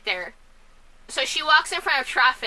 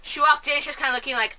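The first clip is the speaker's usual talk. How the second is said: normal talking voice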